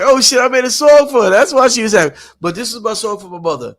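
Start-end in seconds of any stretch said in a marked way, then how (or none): 0.0-2.1 high, loud surprised exclaim; sudden realization. Retold or imitated. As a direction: realize it all at once, loud. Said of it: "I'm like".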